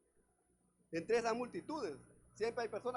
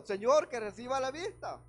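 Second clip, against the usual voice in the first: louder, earnest plea; pleading call; 7 dB louder than usual. Acted. Blind man boldly asks Jesus for his sight, calling out louder. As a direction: cry out your one real wish. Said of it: "No, le dijo".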